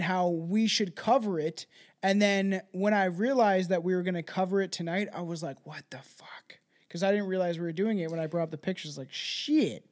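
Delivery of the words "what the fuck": whispered, much softer stunned curse; shocked whisper. Retold or imitated. Host realizing the coincidence, stunned whisper. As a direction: breathe it out in disbelief.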